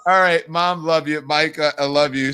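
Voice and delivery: normal talking voice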